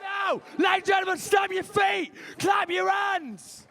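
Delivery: loud, high hype introduction; announcer hyping the next comedian onstage; MC announcer shout. Real, not acted. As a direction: bring the next act on big.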